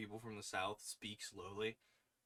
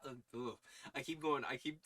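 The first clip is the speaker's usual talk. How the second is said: ordinary talk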